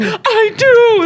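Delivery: hammed-up hurt declaration of love; whiny boyfriend.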